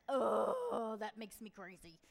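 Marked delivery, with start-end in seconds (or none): 0.1-1.0 loud, exasperated "oh"; exasperated groan. Acted. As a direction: groan loudly, driven crazy by it. Exasperated by whining.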